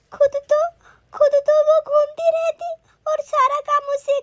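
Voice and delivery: sobbing, high-pitched apology and complaint; tearful crying. Acted. Daughter-in-law begging mother-in-law's forgiveness, crying. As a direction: pour it out through tears, voice rising. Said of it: "बहू तू क्यों रो रही है".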